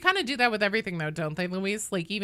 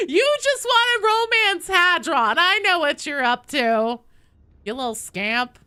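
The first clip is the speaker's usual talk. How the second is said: teasing; 9 semitones higher, 7 dB louder than usual. Real, not acted. Teasing a chatter playfully.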